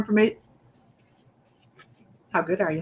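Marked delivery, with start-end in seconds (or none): none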